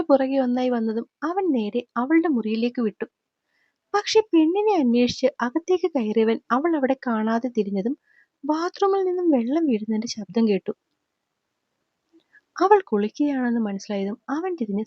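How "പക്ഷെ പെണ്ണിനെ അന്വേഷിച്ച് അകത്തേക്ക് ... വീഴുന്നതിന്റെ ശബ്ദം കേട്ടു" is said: plain reading voice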